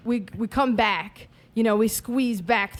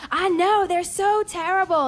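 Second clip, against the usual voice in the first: high, slower mock mom; mom agreeing; 8 semitones higher, slower than usual. Mom answering the lady in the bathroom, high and slow. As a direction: lift the pitch for mom. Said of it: "And my mom's like".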